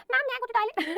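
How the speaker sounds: a hint of a little child's thin voice; lightly voiced small child asking teacher; thin kid voice. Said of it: "पतली आवाज होती है". (हल्की सी पतली बच्चे जैसी आवाज़)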